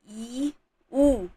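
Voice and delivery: ई and उ said slowly and clearly as model vowels; vowel demo. Shown as a demonstration. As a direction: sound out ई, उ for the class. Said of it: "जैसे यहाँ पर आ". (स्वरों का स्पष्ट उच्चारण)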